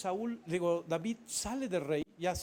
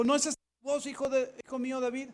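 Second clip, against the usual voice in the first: 1 calling out; 7 semitones higher, 6 dB louder, faster than usual. Acted. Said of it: "conociendo Saúl la voz".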